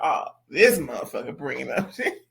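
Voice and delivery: low, irritated grumble with a laugh; ticked off. Retold or imitated. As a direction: mutter it low, annoyed but amused. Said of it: "He's like".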